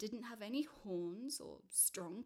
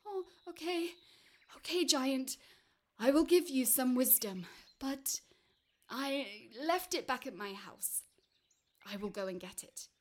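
nervous little man; 5 semitones higher, 9 dB louder than usual. Acted. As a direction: give in hastily to a giant. Man hastily agrees with the giant.

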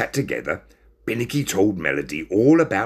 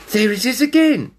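high, louder frightened cat; 6 dB louder than usual. Acted. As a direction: say it out loud, scared. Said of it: "he said out loud".

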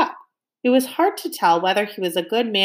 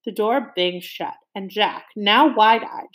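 ordinary delivery, nothing marked

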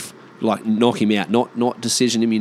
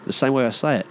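usual speaking voice

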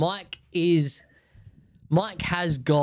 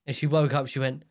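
ordinary talk, nothing marked

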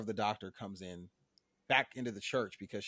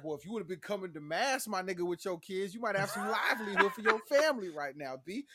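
higher, sassy put-down; mock Bev scolding; 6 semitones higher than usual. Bev turns away the crying man in a higher sassy voice. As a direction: dismiss him with pitched-up sass.

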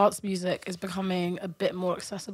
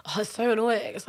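ordinary talk

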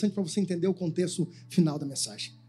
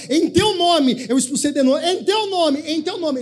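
pleading believers; 8 semitones higher, 3 dB louder than usual. Retold or imitated. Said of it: "ele vai dizer assim".

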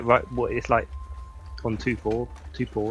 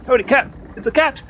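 shouting in shocked delight; excited shout; 12 dB louder than usual. Acted. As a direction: yell it out, wide-eyed. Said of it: "So I'm gonna go".

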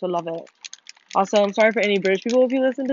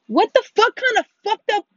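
high, loud, indignant yelling; real outraged shouting; 11 semitones higher, 6 dB louder than usual. Real, not acted. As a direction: yell in outrage at a foul move. Outraged at being attacked in game.

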